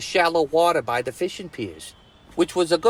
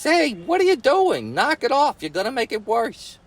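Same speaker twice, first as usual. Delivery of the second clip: put-on annoyed boy; 8 semitones higher than usual. Johnny scolds Cheryl at hole.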